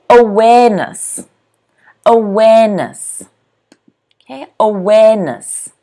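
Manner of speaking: "awareness" with WARE stretched and stressed; stress on second syllable. Shown as a demonstration. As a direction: model "awareness", leaning on a-WARE-ness. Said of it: "stress again is on the second syllable".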